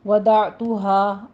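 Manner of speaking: وضعتها drawn out very slowly; وضعتها recited syllable by syllable; slow teacher's model of وضعتها; tajweed pronunciation. Shown as a demonstration. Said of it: "اب یہ لفظ change ہے".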